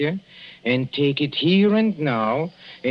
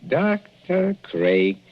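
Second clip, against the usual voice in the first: slow, pointed repetition; much slower than usual. Acted. Husband turns rival's name over suspiciously.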